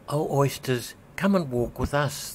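lightly voiced walrus, sweet-talking; beseeching walrus. A narrator's reading. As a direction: walrus coaxing the oysters to walk, lightly pleading. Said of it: "the walrus did beseech".

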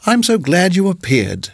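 a hint of a doting hostess; sweet landlady. A narrator's reading. Landlady voiced by narrator.

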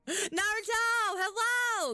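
slow, high sing-song greeting; cute high voice. Pretend. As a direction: greet a pet sweetly.

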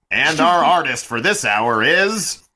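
grand mock-epic announcer calling the roster; booming announcer. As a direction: boom out the names like a ring announcer. Announcer introduces the artist.

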